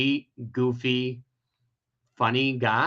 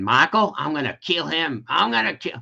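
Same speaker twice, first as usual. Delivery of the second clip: higher, hammed-up Southern accent; 4 semitones higher than usual. Retelling her uncle's threat to kill, infuriated Southern accent. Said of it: "I'm gonna do a Southern accent".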